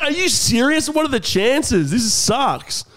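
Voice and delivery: high, wailing mock despair; hammed-up incredulous lament; exasperated disbelief. Re-enacts his dismay at the timing.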